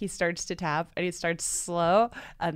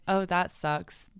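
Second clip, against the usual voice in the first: slow deadpan; 3 dB quieter, much slower than usual. Retold or imitated. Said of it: "old dude's like".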